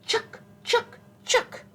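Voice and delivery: high, sharp squirrel chucks; squirrel chatter. A narrator's reading. Teacher showing children what mama squirrel says, high squirrel chatter. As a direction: squeak it out in quick separate bursts. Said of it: "she'd say".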